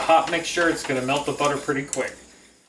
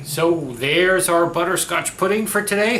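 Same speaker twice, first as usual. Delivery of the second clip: usual speaking voice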